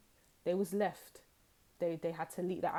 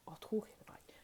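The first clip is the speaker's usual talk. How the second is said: hushed gossipy whisper; 3 semitones higher, 5 dB quieter, much faster than usual. Pretend. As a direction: whisper it like a secret. Gossip revealed secretly.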